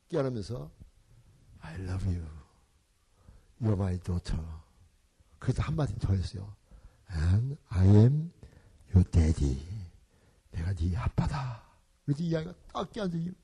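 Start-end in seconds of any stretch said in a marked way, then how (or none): 1.6-4.4 low, soft and slow loving father; tender fatherly words. Retold or imitated. Father tenderly embracing adopted daughter. 7.1-11.6 much lower, very slow fatherly vow; solemn tender declaration. Retold or imitated. Father declaring himself her daddy.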